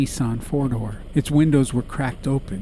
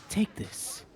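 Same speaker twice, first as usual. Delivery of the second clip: whisper; 9 semitones higher, 9 dB quieter than usual. Acted. Ma pushing food.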